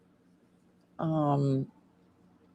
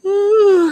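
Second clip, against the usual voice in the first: a long, loud, high yawn; big yawn; 9 dB louder than usual. Real, not acted. Tired seller yawning at her viewers, a loud real yawn. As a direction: yawn out loud mid-sentence. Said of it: "Now I'm yawning in your faces".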